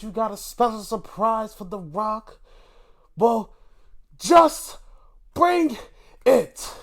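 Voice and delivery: building to a loud, high "just bring it"; The Rock impression. Imitating The Rock calling out a surprise, loud promo voice. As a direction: hype it like a wrestling promo, punching each word. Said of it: "He said".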